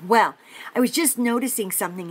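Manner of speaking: ordinary delivery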